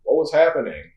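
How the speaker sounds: mock stoic hero delivery; deadpan. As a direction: imitate a superhero showing no emotion. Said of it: "I was deadpan right there".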